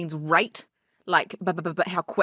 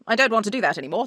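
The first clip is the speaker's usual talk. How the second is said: normal talking voice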